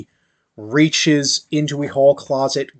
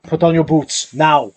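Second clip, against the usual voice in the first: a heavy Arnold-style father's command, a bit louder; gruff order to put boots on, overdone; too much Arnold; 3 semitones higher, 5 dB louder than usual. Acted. Father ordering his son to get his boots on, in an overdone Arnold voice. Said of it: "Went a little bit too much Arnold there".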